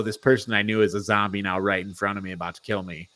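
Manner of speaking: normal talking voice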